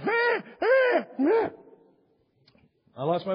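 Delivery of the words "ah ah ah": very high, loud mock puppy cry; hammed-up dog whimpering. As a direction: wail like a tiny dog in trouble. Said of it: "And he's crying".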